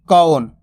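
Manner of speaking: कौन with a full au glide, firm and falling; pronunciation demo. Shown as a demonstration. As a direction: model कौन as k-au-n. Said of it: "इसी तरीके से इसको उच्चारण करना".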